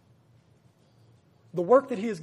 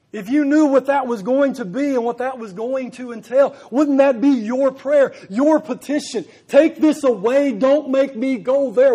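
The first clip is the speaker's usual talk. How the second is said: louder and louder, pleading with the congregation; preaching at full force; 4 semitones higher, 9 dB louder than usual. Acted. Preacher to his brethren about the cross, rising impassioned preaching. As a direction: build to a ringing sermon climax. Preacher builds to imagined prayer.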